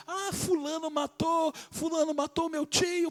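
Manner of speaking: very high, slow, frantic yelling; relatives shouting. Retold or imitated. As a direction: cry out in grief and rage at a killing. Relatives shouting over the killing.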